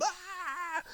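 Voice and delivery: high, sudden pretend scream; mock scream. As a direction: let out a shrill imagined yelp of panic. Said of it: "I'd scream".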